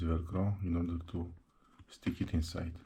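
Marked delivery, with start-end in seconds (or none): none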